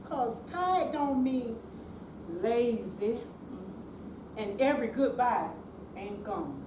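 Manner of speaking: normal talking voice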